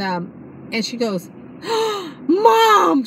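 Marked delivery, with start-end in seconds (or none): 1.4-2.2 sharp high gasp of surprise; mock excited gasp. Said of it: "She was so excited". 2.3-3.0 high, loud excited call of Mom; imitating daughter's excited shout; excited cry. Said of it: "She was so excited".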